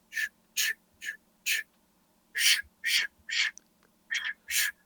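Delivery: breathy ch- ch- for hooves in leaves; soft unvoiced crunch imitation; whispered footstep sounds. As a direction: hiss out the deer's steps softly. Mimicking deer steps in leaves.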